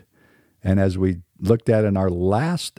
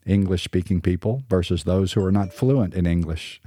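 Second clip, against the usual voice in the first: ordinary talk, nothing marked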